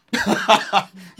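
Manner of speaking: high, loud laugh; short burst of loud laughter. Real, not acted. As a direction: burst out laughing mid-thought. Laughs at dismissing old belief.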